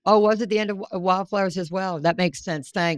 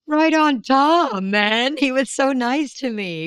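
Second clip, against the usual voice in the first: gushing; 6 semitones higher, 4 dB louder, slower than usual. Retold or imitated. Mock grateful quote, slow high.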